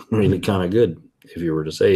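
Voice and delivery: ordinary delivery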